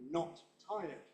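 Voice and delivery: "Not" and "tired" both stressed; two stresses. Shown as a demonstration. Teacher repeating negative example, two stresses. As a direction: give "Not" and "tired" a beat each. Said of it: "Two stresses on not".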